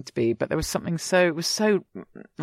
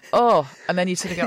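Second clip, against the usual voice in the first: usual speaking voice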